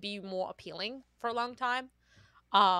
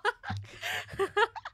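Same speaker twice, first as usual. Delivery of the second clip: high squealing laugh. Real, not acted.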